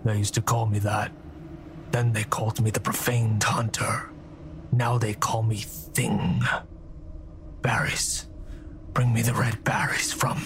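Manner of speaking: low hoarse creature whisper; low, unvoiced, menacing whisper; eerie whisper. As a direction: whisper like a ruined hunter in the dark. Eerie prisoner voice.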